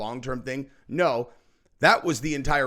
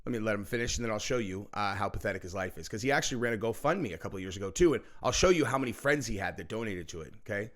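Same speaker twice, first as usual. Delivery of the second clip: ordinary talk, nothing marked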